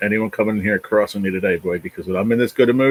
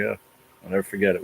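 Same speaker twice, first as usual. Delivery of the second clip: ordinary talk, nothing marked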